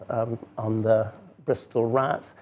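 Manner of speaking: usual speaking voice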